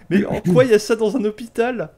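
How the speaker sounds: high, loud, laughing delight; laughing excitement. Real, not acted. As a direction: laugh through it, thrilled. Loving the new weapon.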